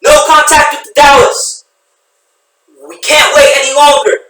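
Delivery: yelling over helicopter noise; shouting. Acted. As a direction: shout it over roaring rotors. Helicopter crew shouting over rotors.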